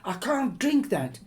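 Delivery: high, loud panicked shriek; panicked daughter shrieking at her mother. Acted. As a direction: shriek it out in horror. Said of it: "she shrieked again and again".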